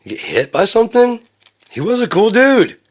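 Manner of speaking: acted high, loud disbelief; high-pitched bewilderment. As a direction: squeal in puzzled dismay. Neighbours puzzle over him, acted.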